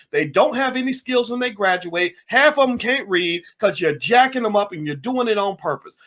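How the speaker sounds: ordinary delivery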